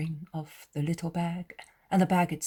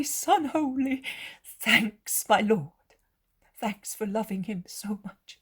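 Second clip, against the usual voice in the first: high, emotional mother, louder than usual; overjoyed, reviving; 6 semitones higher, 5 dB louder than usual. A narrator's reading. Mother thanks Jesus for loving her son, overjoyed. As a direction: pour out relief and joy in a high voice. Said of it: "Mary resembles a withered flower that revives".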